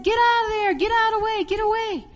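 high, loud acted shout; panicked mother's shout, re-enacted; urgent warning yell. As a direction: yell the children away from danger. Mother yells children away from snake.

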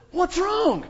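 high, loud startled questions on waking; startled yell. Retold or imitated. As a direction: jolt awake and yell in alarm. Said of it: "I came screaming awake".

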